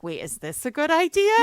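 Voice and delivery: giggly mock alarm as a reader; laughing mock doubt. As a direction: ask it through a laugh, half joking. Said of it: "And then you're like".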